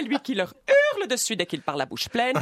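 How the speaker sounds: ordinary talk, nothing marked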